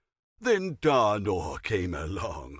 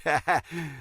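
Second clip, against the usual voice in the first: brief amused laugh after the joke; smug chuckle; 5 semitones higher than usual. Acted. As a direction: savour your own humour. Laughs at his own joke.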